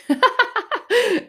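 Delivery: big high burst of laughter; bright real laugh; loud laugh. Real, not acted. Laughs at own story.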